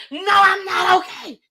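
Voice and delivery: high, loud, indignant denial; exasperated shout. Retold or imitated. Shaken woman snaps at concerned worker, exasperated shout. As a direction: snap back in retold outrage. Snapping at man who asked.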